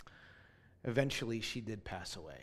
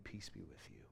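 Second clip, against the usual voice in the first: hushed blessing; 3 semitones lower, 14 dB quieter than usual. Retold or imitated. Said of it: "someone was saying to me".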